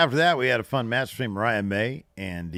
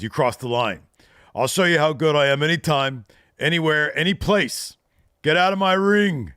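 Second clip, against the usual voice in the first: higher, louder hammed-up defiance; mock angry Hook; 8 semitones higher, 8 dB louder than usual. Host voicing Hook throwing Jericho out, higher and louder. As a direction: bark it like a young tough guy. Retold Hook's angry reply.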